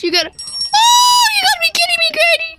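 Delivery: high frantic screams; panicked screaming. Real, not acted. Granny catching player.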